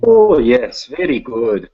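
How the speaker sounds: hammed-up, not very good Russian accent; bad Russian accent. Shown as a demonstration. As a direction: put on a clumsy, mannish Russian accent. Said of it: "when I do a Russian accent".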